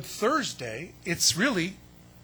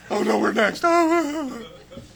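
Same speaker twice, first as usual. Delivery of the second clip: high, loud hammed-up dread; comic panicked wail; mock panic; 5 semitones higher, 10 dB louder, slower than usual. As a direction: wail it like you're sure they're coming for you. Disciples fearing arrest.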